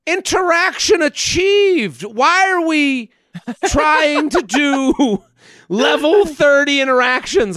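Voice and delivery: high, slow, drawn-out exasperation; mock announcement. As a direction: stretch each word in comic disbelief. Mock triumph, then exasperation.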